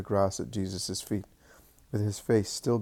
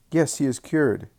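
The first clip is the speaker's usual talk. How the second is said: louder and higher, overjoyed; joyful shout; 5 semitones higher, 5 dB louder than usual. A narrator's reading. Samuel seeing his friend healed, shouting for joy. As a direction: burst out with joy. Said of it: "shouts out of joy".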